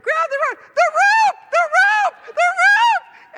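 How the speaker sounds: very high, loud screaming; frantic screams going backwards; panicked scream. Retold or imitated. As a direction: shriek at the rope as you slide back. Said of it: "and I'm going".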